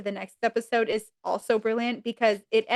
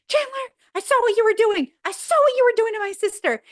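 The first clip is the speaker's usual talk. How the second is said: high, loud furious yelling; mock rage at his sister's boyfriend; enraged brother; 10 semitones higher, 5 dB louder than usual. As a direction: bang on the door and yell at him. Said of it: "he's not got his rage in control".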